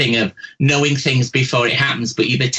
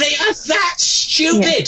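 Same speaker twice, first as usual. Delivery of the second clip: high, slow, leaning hard on are; indignant repeat; 13 semitones higher, slower than usual. Real, not acted. Psychic insisting to caller her bosses are stupid, slow indignant stress. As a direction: drive the point home with disbelief.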